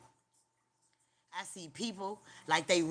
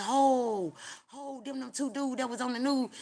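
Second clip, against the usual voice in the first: higher, startled oh of recognition; higher surprised realization; 6 semitones higher than usual. Retold or imitated. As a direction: gasp as you spot them. Recognising faces in hell.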